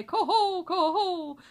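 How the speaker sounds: high, loud laughing 'Coho'; swan cry. Acted. Father swan showing his children the flight cry, high loud call. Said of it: "as we cry".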